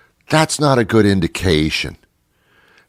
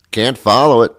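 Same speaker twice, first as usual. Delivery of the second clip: ordinary talk, nothing marked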